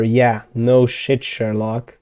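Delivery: ordinary talk, nothing marked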